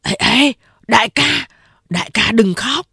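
flustered henchman; alarmed. A narrator's reading. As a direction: rush in to stop him crying. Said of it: "Hai Hồ nói".

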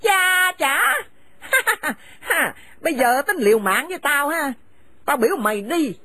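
harsh, contemptuous scolding of a daughter-in-law; bitter older woman driving her son's wife from the house; angry scolding mother. Acted. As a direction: spit the insults at her and order her out.

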